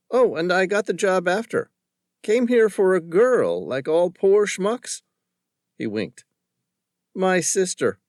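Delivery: lightly voiced joking young man; teasing Josh. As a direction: toss it over your shoulder with a wink. Josh's parting joke.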